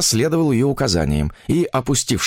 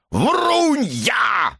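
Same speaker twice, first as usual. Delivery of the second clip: put-on high, squealing old man's howl; frenzied, screechy outburst; furious shrieking yell; 13 semitones higher than usual. A narrator's reading. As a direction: scream the accusation in rage. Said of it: "визгливом вопле старика".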